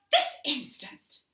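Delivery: slow, loud, stern mother; stern command. A narrator's reading. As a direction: lay down the law. Mother orders again.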